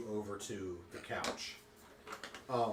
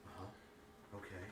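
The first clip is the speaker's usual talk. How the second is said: quiet; 3 semitones lower, 16 dB quieter, slower than usual. Retold or imitated. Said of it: "he's listening".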